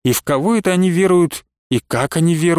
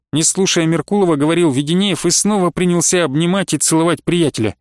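ordinary delivery

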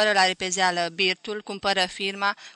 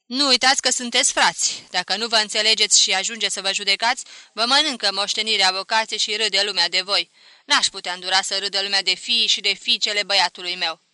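put-on louder, firm old grandmother laying down the law; stern grandmother; 8 dB louder than usual. A narrator's reading. Grandmother warning her heirs at the will sharing, stern and loud. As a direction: warn them like a strict matriarch.

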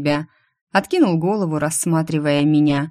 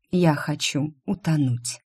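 ordinary delivery

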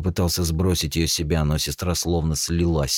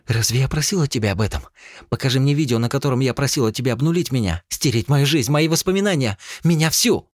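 higher, louder, fast accusing cries; angry shouting; 11 semitones higher, 4 dB louder than usual. Acted. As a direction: hurl the accusation at him. Furious accusation at brother.

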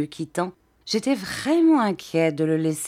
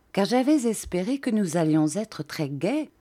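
usual speaking voice

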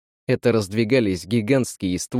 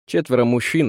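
ordinary delivery

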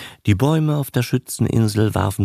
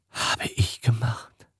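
whispered guilt; 2 semitones higher, 7 dB quieter than usual. A narrator's reading. Old man's guilt.

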